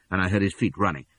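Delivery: ordinary delivery, nothing marked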